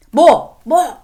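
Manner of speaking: loud, high defiant what; angry snap back. Retold or imitated. Teen son snapping at his scolding mother, loud and defiant. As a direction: fire it back at her.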